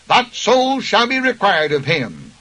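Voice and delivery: normal talking voice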